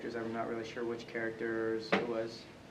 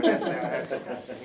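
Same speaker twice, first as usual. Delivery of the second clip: louder, laughing as an indignant fan; laughing fan quote; 9 dB louder than usual. Retold or imitated. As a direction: play a fan laughing in protest. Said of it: "people might be like".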